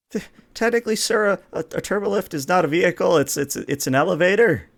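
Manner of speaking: high squeaky nervous ensign. Acted. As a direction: squeak it out, flustered. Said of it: "I love the squeaky voice".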